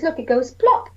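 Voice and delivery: ordinary talk, nothing marked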